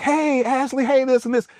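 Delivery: high, bubbly hostess voice; imitating upbeat greeting. Husband mimicking wife greeting celebrities, high and bubbly. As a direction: mimic her chirpy event greeting.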